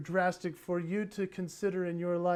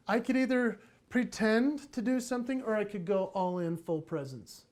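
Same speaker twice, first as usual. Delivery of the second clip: ordinary talk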